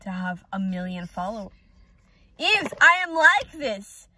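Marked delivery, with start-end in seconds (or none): 2.4-4.1 dramatic pleading. Real, not acted. Defending her vlog persona.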